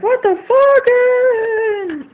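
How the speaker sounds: very slow, stretched-out high disbelieving yell; outraged dad. Retold or imitated. Dad reacting to the tattoo, drawn-out high outraged yell. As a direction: drag every word out in outrage.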